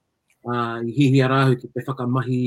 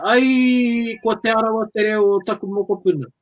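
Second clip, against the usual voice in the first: a higher, lightly voiced old kuia; imitating her grandmother; 7 semitones higher than usual. The kuia teasing her grandchild for her Te Arawa accent, lightly voiced and higher. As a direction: mimic the teasing kuia, pitched up a little. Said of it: "Ka me taku kuia".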